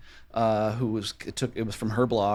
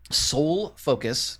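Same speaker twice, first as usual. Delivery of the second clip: slow Minnesota vowels on Soul Focus; drawn-out Minnesota "Soul Focus"; Minnesota accent; much slower than usual. Shown as a demonstration. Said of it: "Can you hear my Minnesota accent".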